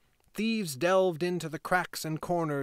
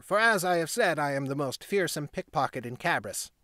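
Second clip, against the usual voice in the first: put-on proud boy; 3 dB louder than usual. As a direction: brag like the little gang leader. Boy boasting of his gang.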